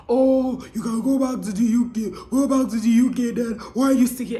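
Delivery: lightly mocking commenter voice; imitated online critics. Vlogger imitating critics telling her to leave Canada, lightly mocking. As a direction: lightly put on a complaining commenter. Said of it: "start saying things like".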